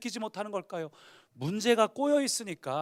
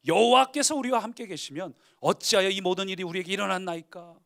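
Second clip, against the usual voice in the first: solemn complaint; 8 dB louder than usual. Acted. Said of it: "기드온이 그에게 대답하되".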